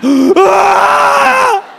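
long, high, loud mock scream; gasp, then scream in comic horror; horrified scream. Comedian learning theatres have closed, horrified scream.

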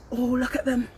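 ordinary delivery, nothing marked

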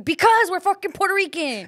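acted exasperation; exasperated comeback. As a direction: snap back as if the question is stupid. Acted snappy comeback to question.

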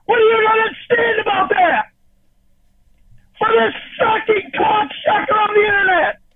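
yelling at Jamie through tears; furious crying shout. Real, not acted. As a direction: scream it through tears at her.

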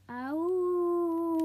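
high loud drawn-out howl; dog howl. Acted. Patches the dog howling in Shay's room. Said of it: "she howled".

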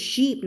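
"cheap" mispronounced as "sheep"; wrong SH for CH. Shown as a demonstration. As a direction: swap the ch of "cheap" for an airy sh. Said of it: "อย่าไปออกเสียง cheap นะ เสียงไม่เหมือนกัน". (ออกเสียง cheap ผิดเป็น sheep)

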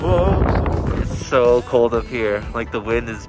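muffled behind balaclava; through a face mask. Real, not acted. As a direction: mouth covered by fabric. Seen 0: Dark face covering over mouth and chin throughout selfie shots.

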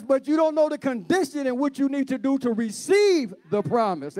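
high, driving sermon voice; fervent preaching. Real, not acted. Preacher challenges church on promises and conditions, fervent preaching. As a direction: press the point home to the congregation.

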